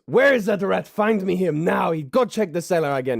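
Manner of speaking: high, loud demand; angry chef. Acted. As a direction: snap at the assistant to find the rat. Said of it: "He goes".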